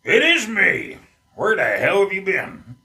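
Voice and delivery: loud, high-pitched hammed-up reply; mock grandfather voice. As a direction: play the grandfather in a comic reunion skit. Acts grandfather answering in skit.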